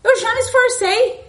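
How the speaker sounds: loud, high, laughing retort; laughing outburst. Real, not acted. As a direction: blurt it out through a laugh. Answering daughter's advice with laughter.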